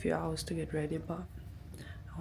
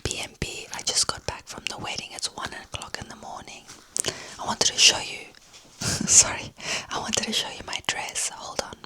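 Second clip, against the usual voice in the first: hushed whisper so nobody wakes; 5 semitones higher, 3 dB louder than usual. Real, not acted. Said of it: "I have to whisper because I think there's other people".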